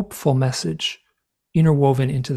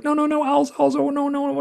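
imitating a sister squealing no, much higher; mock panicked sister; 13 semitones higher than usual. Sister refusing to hear about owls, mock squeal. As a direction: play the squeamish sister blocking her ears.

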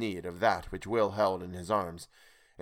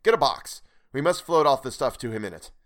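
louder, urgent orders; hurry, we must help him; urgent sympathy; 4 semitones higher, 6 dB louder than usual. Acted. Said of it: "vast sympathy".

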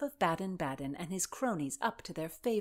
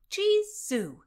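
high, loud startled oath; shocked exclamation; 12 semitones higher, 7 dB louder than usual. A narrator's reading. Heroine tossed about by mad revellers, shocked. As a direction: burst out with it, appalled.

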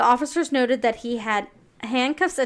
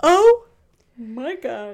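shocked; 7 dB louder, much slower than usual. Real, not acted. Reacting to grim story detail.

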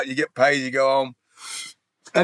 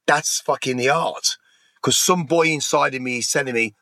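ordinary talk, nothing marked

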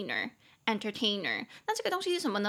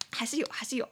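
whisper; 2 semitones higher, faster than usual. Real, not acted. Embarrassed aside after slip.

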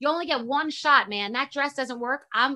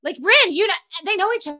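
loud, high flustered over-explaining; choking socially; 4 semitones higher, 7 dB louder than usual. Retold or imitated. Mom introducing herself to daughter's friend, flustered loud blurting. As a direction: blurt it out, imitating an awkward overeager parent. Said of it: "I fully choked".

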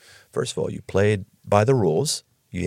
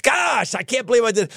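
dismayed; 10 semitones higher than usual. Retold or imitated. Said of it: "they were like".

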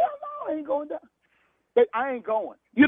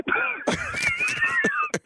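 relieved laugh; 6 dB quieter than usual. Real, not acted. Victim learns of prank.

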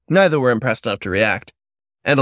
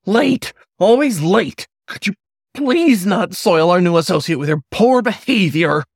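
high, loud, whistling scold; kettle whistle voice; 9 semitones higher, 4 dB louder than usual. A narrator's reading. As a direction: screech at him like a boiling kettle. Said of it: "he said in a kettle whistle voice".

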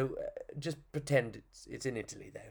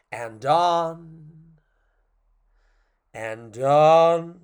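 dragged out; 3 semitones higher, 10 dB louder, much slower than usual. Acted. Storyteller showing the puppet going on and on, dragged out and swelling. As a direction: stretch each "on" out long, swelling louder. Mimicking endless moaning, dragged out.

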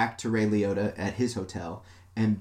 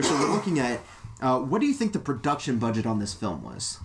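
ordinary talk, nothing marked

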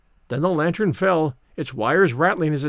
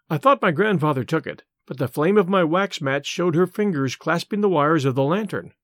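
plain reading voice

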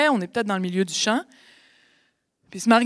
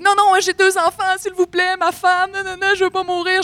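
very high, loud panicked begging; mock pleading victim; 11 semitones higher, 5 dB louder than usual. Mafia victim with feet in concrete, panicked begging. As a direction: play the mafia victim begging for his life. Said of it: "le gars avec les pieds dans le béton, il dit".

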